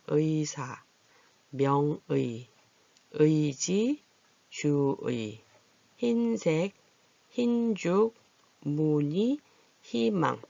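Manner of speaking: 의사 to 희망 one word at a time, evenly spaced; word-list reading. Shown as a demonstration. Teacher rereads the words for learners, deliberate list reading. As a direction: read 명의, 주의, 흰색 as separate model words. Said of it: "다시 한번 단어들을 읽어보겠습니다".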